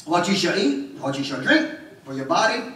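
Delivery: fervent sermon, pressing the congregation; preaching. Acted. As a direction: preach it out with full conviction.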